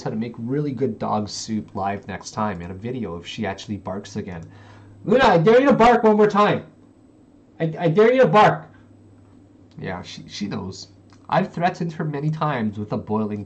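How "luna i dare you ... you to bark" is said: loud, high warning yell; shouted dare. Real, not acted. Streamer daring the dog to bark once more, shouting.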